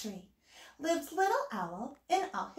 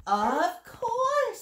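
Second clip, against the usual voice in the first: high, louder storybook welcome; welcoming spider; 9 semitones higher, 5 dB louder than usual. A narrator's reading. Spider invites little Owl onto the web, high and welcoming. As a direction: sing out a gracious yes, pitched up.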